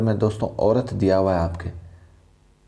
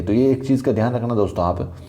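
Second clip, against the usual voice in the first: ordinary talk, nothing marked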